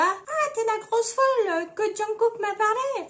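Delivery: high put-on voice; silly high character delivery. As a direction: blurt it in a high mock voice. Run-through of [name]'s lines.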